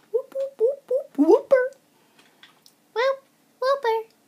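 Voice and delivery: high, put-on whoops; goofy high-pitched whooping; silly whoop. Whooping to himself while building in a game.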